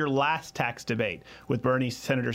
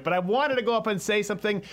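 normal talking voice

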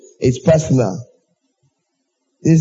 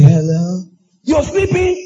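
mock surprised call; 5 semitones higher, slower than usual. Mock phone call waking friend.

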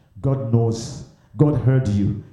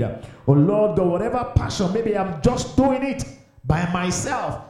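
earnest prayer voice, words for all to repeat; lead prayer; 5 semitones higher than usual. Real, not acted. Preacher leads the church in a spoken prayer, earnest. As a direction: pray aloud for the congregation to follow.

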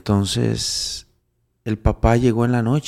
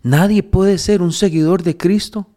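high, loud pulpit voice, slow at the start; rising sermon fervor, voice pushed up high; fervent preaching; 6 semitones higher, 5 dB louder, slower than usual. Acted. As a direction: preach it hard, pressing each claim. Preaching to Andrés.

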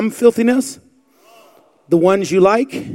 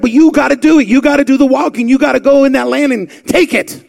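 louder and higher, driving preacher cadence; full-force preaching; 5 semitones higher, 5 dB louder than usual. Acted. Pastor urging the church to take the land, fervent preaching. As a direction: drive every line home to the pews.

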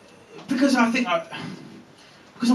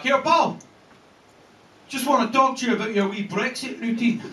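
higher-pitched Scottish voice; imitated Scottish accent, raised pitch; 5 semitones higher than usual. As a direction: do a Scottish punter collaring the comedian. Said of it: "a Scottish guy who wants to talk".

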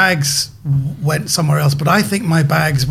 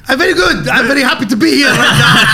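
put-on a thick Iranian accent, higher and louder; 7 semitones higher, 4 dB louder than usual. Comedian greets the hosts in a thick Iranian accent. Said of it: "what kind of level of accent you want".

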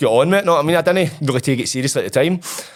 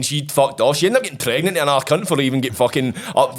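normal talking voice

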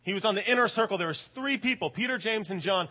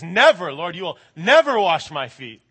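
louder, emphatic mock refusal from Peter; 10 dB louder than usual. Peter refusing Jesus, loud and emphatic. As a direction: insist loudly, retelling Peter.

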